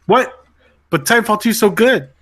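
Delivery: high, loud disbelief; incredulous protest. Real, not acted. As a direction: object in dismay, pitch jumping up. Protests cutting a favorite game.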